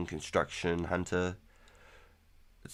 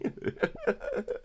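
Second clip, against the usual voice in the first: laugh. Real, not acted. Laughing at his own wish.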